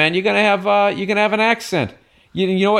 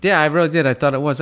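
usual speaking voice

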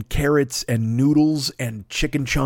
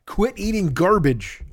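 a lightly voiced scolding liver, slow at the end; mock furious organ berating its owner; angry liver; 5 semitones higher than usual. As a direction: scold yourself as your fed-up liver. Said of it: "my liver's gonna just jump out and slap".

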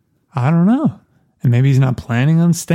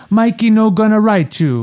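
put-on higher, heavy Italian Mama Corleone accent; strong Italian accent; 8 semitones higher than usual. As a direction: read her lines as a thick Italian mother. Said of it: "very strong Mama Corleone accent".

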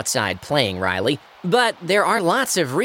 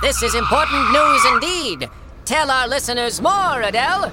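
higher, formal newsreader delivery; news anchor voice; 10 semitones higher than usual. Acted. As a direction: announce it like a TV anchor. Anchor continues.

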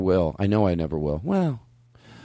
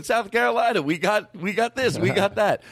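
higher-pitched mock pitchman delivery; ad pitchman imitation; 9 semitones higher than usual. Host mimicking the state ad bit, high mock pitch. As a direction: imitate the promo guy, pitched well up. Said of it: "You know, he's going".

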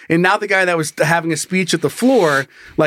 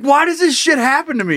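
exasperated lament; 8 semitones higher than usual. Retold or imitated. Exasperated lament retold in story.

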